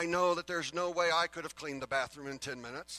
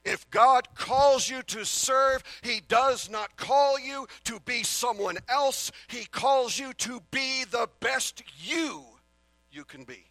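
slow, much louder and higher sermon cry; preaching at full force; 8 semitones higher, 9 dB louder, slower than usual. Acted. Preacher urging listeners not to believe the lie, ringing loud. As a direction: drive it home to the congregation slowly.

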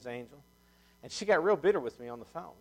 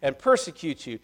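higher, louder pulpit reading; preached scripture reading; 6 semitones higher, 6 dB louder than usual. Real, not acted. Preacher reading scripture to the congregation, raised declaiming voice. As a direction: declaim the verses with force.

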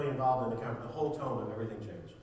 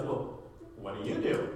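mock punk guy; 3 semitones lower than usual. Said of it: "he looks at me and goes".

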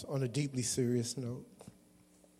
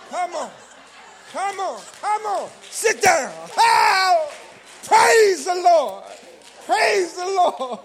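high, loud, shouted preaching; fiery revival shout; preaching at full force; 18 dB louder, slower than usual. Acted. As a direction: whip the congregation up, shouting praise.

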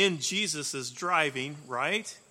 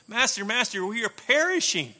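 panicked cry; 6 semitones higher, 8 dB louder than usual. Retold or imitated. Terrified disciples wake Jesus.

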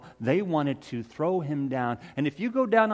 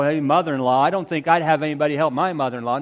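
mock listener voice; 7 dB louder, much faster than usual. Peter mocking the crowd's mother-in-law grumble, fast and loud. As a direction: rattle off a skeptic's retort fast and loud. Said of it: "And I know what you're saying".

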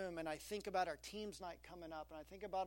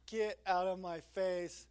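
mock annoyed retort; 7 dB louder, slower than usual. Annoyed reply to gushing elders.

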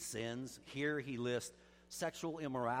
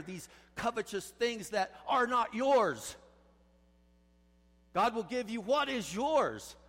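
higher and louder, building sermon fervor; impassioned pulpit climax, higher and louder; full-force preaching; 5 semitones higher, 5 dB louder than usual. Real, not acted. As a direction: preach it hard, rising and driving.

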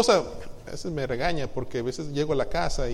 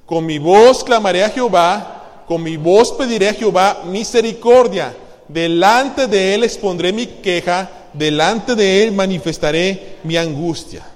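slow, loud, solemn Bible reading; scripture reading; 7 dB louder, slower than usual. Acted. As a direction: proclaim the verse slowly and loudly. (lectura bíblica lenta y solemne)

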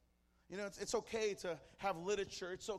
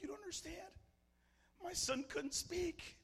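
shaking, crying; 12 semitones higher than usual. Acted. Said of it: "She's shaking and she's crying".

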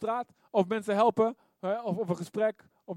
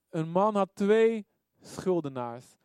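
ordinary delivery

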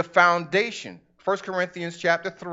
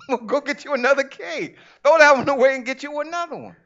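rising exasperation; 7 semitones higher, 4 dB louder, faster than usual. Acted. God answering with rising force.

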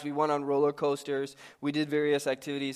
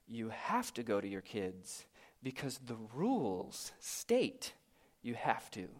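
timid inner voice; 4 semitones lower, 11 dB quieter than usual. Pretend. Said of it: "the first voice won by saying".

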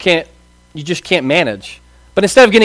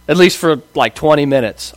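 ordinary talk, nothing marked

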